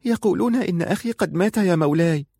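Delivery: put-on a boy with a hoarse voice breaking the news. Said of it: "في صوت مبحوح".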